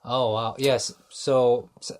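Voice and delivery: normal talking voice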